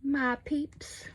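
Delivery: cutesy, high repeat of "My peeps"; playful high voice. Pretend. Playfully repeats her own phrase.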